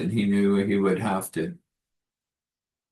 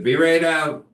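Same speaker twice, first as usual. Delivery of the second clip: raised voice, higher and louder, calling from the back; 7 semitones higher, 5 dB louder than usual. Acted. Cook calling out from the kitchen, raised voice. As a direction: shout it through the kitchen door.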